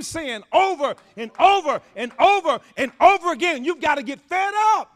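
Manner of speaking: loud, building sermon exhortation; preaching at full force. Acted. Pastor urging the church to get fed up, full-force preaching. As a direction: preach it hard to the congregation.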